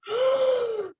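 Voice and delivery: high shocked intake of breath; kid's mock gasp of shock. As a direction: gasp like a kid learning a secret. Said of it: "their faces was like".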